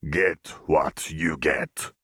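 slow whispered growl of a cook; gruff grunt. A narrator's reading. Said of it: "Jericho grunted".

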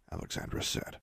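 ordinary delivery, nothing marked

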